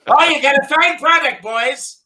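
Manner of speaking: hearty priest praising the beer; hammed-up jolly priest; Father Pat.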